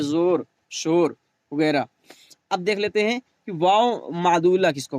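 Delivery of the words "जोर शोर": "शोर" on a plain o vowel; waw majhool. Shown as a demonstration. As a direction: open o in "जोर, शोर", not oo. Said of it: "इसमें नहीं पढ़ा जा रहा है".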